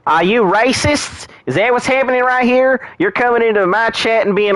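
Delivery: theatrical offended shriek, pitch way up; mock outrage. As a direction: act scandalized at the chat. Mock outrage at chat question.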